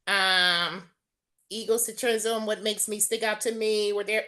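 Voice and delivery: ordinary talk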